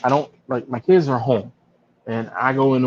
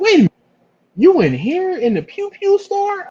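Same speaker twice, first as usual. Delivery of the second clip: imitating a surprised fan, pitched way up; 4 dB louder than usual. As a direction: stop short like you just figured it out. Fan realizes something.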